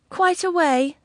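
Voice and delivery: high, wistful question; lightly voiced pining gentleman; languishing suitor. A narrator's reading. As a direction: ask in lovelorn dismay. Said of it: "with a languishing look".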